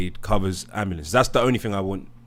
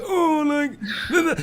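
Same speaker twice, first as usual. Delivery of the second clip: lightly voiced high mock squeal; 14 semitones higher than usual. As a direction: squeak it out as a mocking impression. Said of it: "So they're like".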